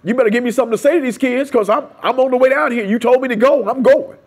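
high, loud, quick demand to God; insistent prayer. Retold or imitated. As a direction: push the plea hard, pitched well up. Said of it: "that was my prayer".

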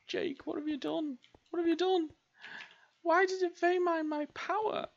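very high, hammed-up panic; squealed dismay. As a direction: squeal at yourself in despair. Panicking at lost power.

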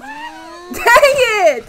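high, loud, slow cry of "dang it"; half-laughing wail of frustration; frustrated shout. Real, not acted. Fails jump again.

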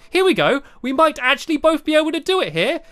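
ordinary talk, nothing marked

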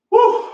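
high, loud woo to pump up; excited whoop. Real, not acted. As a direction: shout a hyped woo before the set. Hype shout starting a set.

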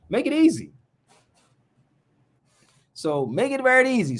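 high, pressing on easy and very; insisting viewers make his job easy; emphatic plea. Real, not acted. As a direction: lean hard on very and easy.

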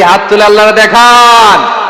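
loud, slow call to the crowd; rally the audience to raise hands; rousing shout. Real, not acted. Preacher urging the crowd to raise hands, loud.

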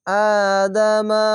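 আদামা chanted slowly, first vowel held; slow recitation of আদামা with long first vowel; one-alif stretch. Shown as a demonstration. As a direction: draw out the opening "aa" of আদামা. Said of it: "এক আলিফ টেনে পড়বেন".

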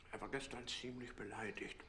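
hushed confidential whisper. Acted. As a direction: lean in and whisper it. Gossips about Taschenbier.

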